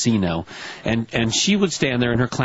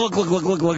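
rapid, higher-pitched clunking noises; imitated tumbling microphone; 5 semitones higher than usual. Recalling the singer's microphone falling downstairs, imitated clunking. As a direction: imitate a mic bouncing down steps.

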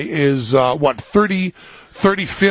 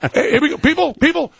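laughing, much higher, hyped-up call to the crowd; mock announcer; 12 semitones higher than usual. Radio host mock-announcing Bomb a Llama Wednesday to listeners, laughing hype. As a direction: ham it up like announcing a holiday.

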